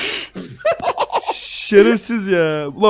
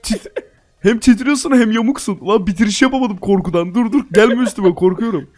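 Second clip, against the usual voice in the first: laughing; 5 semitones higher, 3 dB louder than usual. Real, not acted. Laughing at glitching friend.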